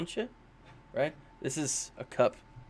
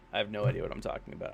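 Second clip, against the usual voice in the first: rushed aside; 7 semitones lower, 8 dB quieter, much faster than usual. Real, not acted. Self-deprecating aside.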